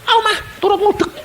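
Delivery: put-on high, laughing little-child voice; a toddler begging to help; eager toddler. Toddler begs mother to let him stir, high and eager.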